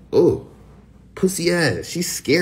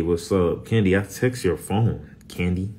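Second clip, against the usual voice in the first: ordinary talk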